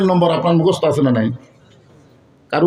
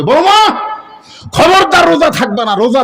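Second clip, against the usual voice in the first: loud, high-pitched retold warning; stern mock warning; 10 semitones higher, 4 dB louder than usual. Grandmother forbidding pregnant daughter-in-law to fast, imitated stern warning. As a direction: imitate a mother-in-law laying down the law.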